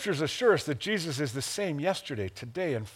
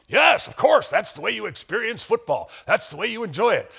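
eager agreement; 7 semitones higher, 4 dB louder than usual. Retold or imitated. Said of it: "I said".

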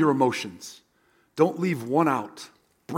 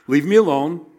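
curt angry God; 5 dB louder than usual. Retold or imitated. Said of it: "same thing he's saying to Moses".

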